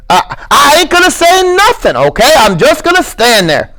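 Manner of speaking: put-on high stammering childlike plea; Lenny, earnest. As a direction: insist like a scared child. Lenny's held voice.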